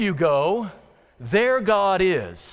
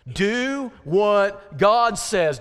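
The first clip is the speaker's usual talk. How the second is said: very slow, each word weighted; slow emphatic; 3 dB louder, much slower than usual. Shown as a demonstration. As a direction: Do what God says, her kelimeyi ağır ve tek tek vurgulayarak. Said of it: "Here's the definition".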